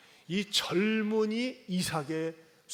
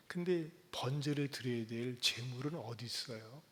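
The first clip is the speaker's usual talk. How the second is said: ordinary delivery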